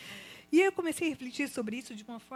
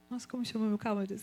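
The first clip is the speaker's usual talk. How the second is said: ordinary talk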